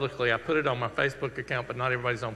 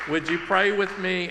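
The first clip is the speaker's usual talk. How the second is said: higher and louder, lifted with energy; eager invitation to pray; 6 semitones higher, 5 dB louder than usual. Real, not acted. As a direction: call the room into prayer, bright and raised. Pastor invites congregation to pray.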